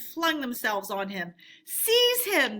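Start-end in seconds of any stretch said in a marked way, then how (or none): 1.6-2.6 high, loud command to grab; cry out. A narrator's reading. Passengers crying out to seize Toad, loud. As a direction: shout to seize him. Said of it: "they cried".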